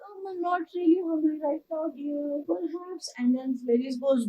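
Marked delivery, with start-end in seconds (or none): 0.1-3.1 high, whimpering squeak; fake-sweet bride voice on the verge of tears; nervous Grover. Grover stalling the Cyclops, high and whimpering. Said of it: "Grover's trying to be like".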